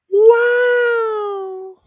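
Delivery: very high, loud "Wow"; mock wow. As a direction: squeal it out in amazement. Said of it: "While Zainab says".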